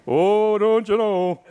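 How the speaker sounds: high, drawn-out Wisconsin catchphrase; hammed-up Midwestern accent, pitched high. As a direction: sing out the long Wisconsin O's. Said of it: "they speak with an accent".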